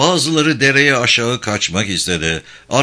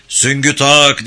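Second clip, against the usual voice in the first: raised, higher military order; shouted command; 4 semitones higher, 5 dB louder, slower than usual. A narrator's reading. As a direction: call out an order to the troops. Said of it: "diye bağırdı". (yüksek sesle komut)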